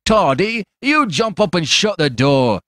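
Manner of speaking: high, drawn-out mocking command; domineering, coarse stranger. A narrator's reading. Stranger ordering Toddy about.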